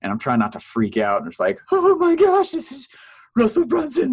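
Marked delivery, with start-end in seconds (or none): 1.7-4.1 far higher, breathless fan squeal; starstruck. Retold or imitated. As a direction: gush like meeting your hero. Starstruck before meeting Russell.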